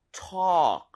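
"talk" with an open aw, silent L; open-mouthed vowel. Shown as a demonstration. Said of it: "입모양을 좀 벌려서".

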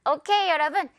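ordinary talk, nothing marked

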